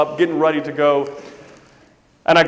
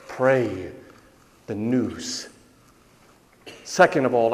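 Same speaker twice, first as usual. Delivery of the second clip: ordinary talk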